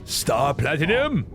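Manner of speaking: high, drawn-out anime battle cry; calling out attacks. Acted. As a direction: cast each name as a hammed-up summons. Character calls out anime attack names.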